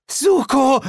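very high, loud, breaking cry; a cry so high it cracks; voice cracking. Acted. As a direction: Shao shrieks for Suko, voice cracking. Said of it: "mit sich überschlagender Stimme".

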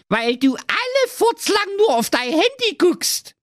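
very high nagging princess. Acted. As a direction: scold him in a shrill high woman's voice. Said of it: "dass die Prinzessin rief".

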